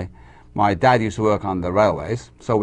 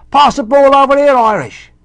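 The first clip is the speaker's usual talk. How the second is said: put-on loud, high shout in an English accent; English kids' accent; 10 dB louder than usual. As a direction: yell like an English kid at football. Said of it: "then I go to England, and they say".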